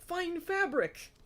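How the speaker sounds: high, drawn-out "fine fabric"; mock wonder. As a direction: stretch the words out slowly in a high pitch. Mock-dramatic lingering on the words.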